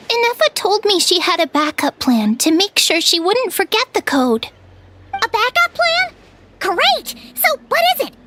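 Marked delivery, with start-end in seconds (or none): 5.1-8.1 high, bright eager questions; eager excitement. Acted. Paimon to Inefa about the backup plan, eager and excited. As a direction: pounce on the good news.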